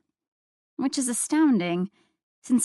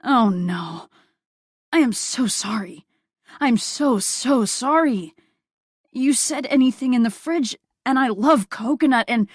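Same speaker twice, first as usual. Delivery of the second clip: louder, gushing, rushed apologies; effusive apology; 3 semitones higher, 5 dB louder than usual. A narrator's reading. Man caught eating the cake, gushing apologies. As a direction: pour out the apology. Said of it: "rush of words were effusively apologetic".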